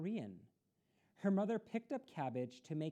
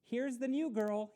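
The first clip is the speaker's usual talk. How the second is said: loud, higher announcement; announcing; 8 semitones higher, 7 dB louder than usual. Acted. Boy loudly announcing the new girl to class. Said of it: "he announced".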